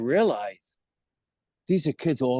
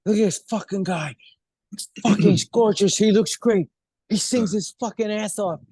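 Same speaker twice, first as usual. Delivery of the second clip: higher, worked-up mock resentment; envious rival's grumbling; 5 semitones higher, 4 dB louder than usual. Retelling Bobby's hatred at the audition, worked-up and resentful. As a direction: rail at the rival who has it all. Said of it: "he saw my audition and he hated me".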